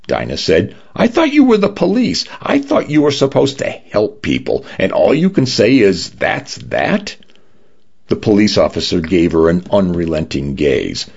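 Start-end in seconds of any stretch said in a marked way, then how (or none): 0.9-6.1 high-pitched, exasperated outburst; acted high, affronted complaint; indignant protest. A narrator's reading. Dinah indignantly confronts the officer. 6.1-7.1 high, soft rising question; acted high-pitched incredulity; incredulous echo. A narrator's reading. Dinah throws his words back incredulously.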